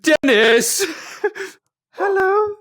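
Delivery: loud, high excited calling. Real, not acted. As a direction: call to him with delight. Meets Dennis.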